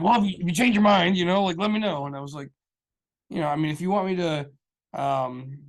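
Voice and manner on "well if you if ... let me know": eager client. Retold or imitated. Quoting client asking him back.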